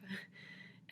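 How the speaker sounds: short breathy laugh under her breath; soft laugh. Real, not acted. Vlogger laughing at her lisp joke, soft chuckle.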